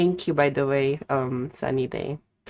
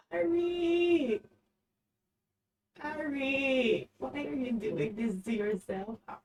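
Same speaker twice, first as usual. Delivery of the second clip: high, laughing call out; high incredulous calling; laughing disbelief; 10 semitones higher, 7 dB quieter than usual. Real, not acted. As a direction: squeal her name in disbelief.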